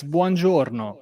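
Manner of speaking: Italian dialect of the US, say buongiorno with a zh sound; Italian-American dialect. Shown as a demonstration. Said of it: "I can also do the Italian dialect in the U S".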